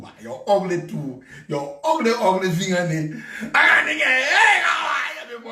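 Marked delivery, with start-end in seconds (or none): 4.0-5.5 hammed-up announcer hello; mock greeting. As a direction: bellow a fake cheerful hello.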